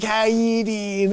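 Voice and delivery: slow, drawn-out put-on greeting; imitating the greeting. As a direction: echo his goofy hello slowly. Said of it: "You know, like".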